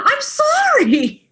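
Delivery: high, slow, sheepish apology; high sorry. Retold or imitated. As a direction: squeak out an apology slowly. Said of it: "And I responded".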